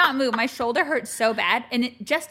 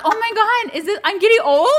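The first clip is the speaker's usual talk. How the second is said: mock alarm; 10 semitones higher, 8 dB louder, much faster than usual. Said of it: "I'm like".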